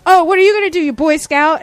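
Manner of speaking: high, loud mock jeer; screaming customer. Customer taunting the cops who came, mock screaming. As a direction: imitate an irate shopper sneering at police. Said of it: "like, screaming and she's".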